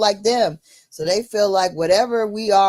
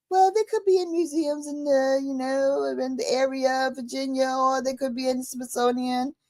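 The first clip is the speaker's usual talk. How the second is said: higher, lightly put-on assistant voice; phone AI voice; 5 semitones higher, 3 dB quieter than usual. The AI suggesting where artifacts are, mock assistant voice. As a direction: answer as the helpful phone AI, a bit higher. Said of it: "talking to me in a British voice".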